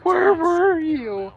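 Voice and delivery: high, slow anguished cry; hammed-up sideline meltdown; coach having a breakdown. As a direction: demand it like a devastated coach. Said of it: "The coach is having a breakdown".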